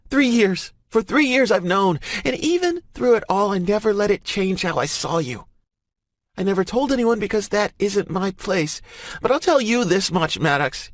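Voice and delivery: high, loud, passionate scolding; fierce woman's voice. A narrator's reading. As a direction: refuse to back down, firmly. Emerson furious and honest.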